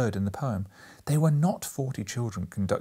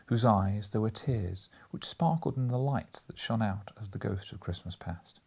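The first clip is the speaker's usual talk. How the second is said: plain reading voice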